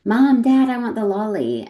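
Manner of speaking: put-on high, loud whining child; whiny toddler. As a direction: beg like a three-year-old in a supermarket. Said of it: "they go".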